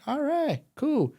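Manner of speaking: high-pitched, soft agreeing; retold young self saying yes in a high voice; easygoing acceptance. His eager acceptance of the wage.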